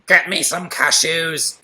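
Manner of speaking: slow put-on wife voice; demanding wife asks for cashews; lightly voiced bossy wife; wife Janice voice. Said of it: "She's got a very interesting voice".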